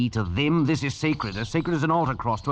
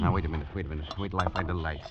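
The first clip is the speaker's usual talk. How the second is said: low, soft reassurance; steady voice calming his friend; 5 semitones lower, 5 dB quieter, faster than usual. Acted. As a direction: settle him down gently. Calming the frightened man.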